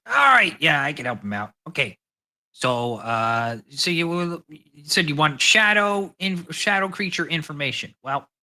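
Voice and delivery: high, loud little gnome voice; gnome Walter. Acted. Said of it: "the gnome kinda from this note says".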